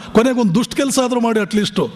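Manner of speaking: normal talking voice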